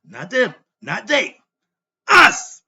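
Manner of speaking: builds to a cracking, crying shout on us; annoyed outburst. Real, not acted. Frustrated teacher crying out to his own people, anguished shout. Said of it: "I'm a little annoyed right now".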